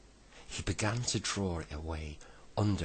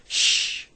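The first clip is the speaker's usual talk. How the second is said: forceful long "shh"; shushing; 12 dB louder than usual. A narrator's reading. Bessie hushing the others, a forceful shush. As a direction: hush them with a sharp, loud shh.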